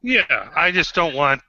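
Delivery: ordinary talk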